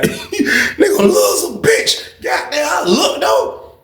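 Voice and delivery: high, drawn-out laughing disbelief; incredulous laughing. Real, not acted. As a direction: squeal it slowly through laughter. Teller cracks up describing photo.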